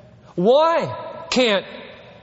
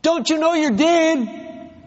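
raised high and pressing; urgent preaching, much higher voice; high impassioned plea; 10 semitones higher, slower than usual. Real, not acted. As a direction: plead with the whole church. Preacher builds to pleading climax.

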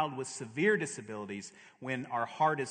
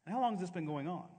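calm doctor; 5 semitones higher, much faster than usual. Retold or imitated. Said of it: "He looks over his glasses and he says".

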